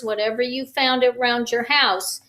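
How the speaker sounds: usual speaking voice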